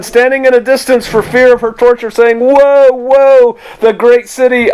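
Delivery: high, lifted preaching read; scripture reading. Acted. Pastor reading Revelation to his flock, lifted and proclaiming. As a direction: proclaim the woe with rising force.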